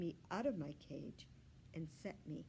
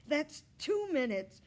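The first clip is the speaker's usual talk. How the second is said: put-on higher, louder boy's announcement; announcing; 10 semitones higher, 12 dB louder than usual. Richie timing the hamster, announcing loudly. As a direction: proudly call the time. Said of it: "Richie announced".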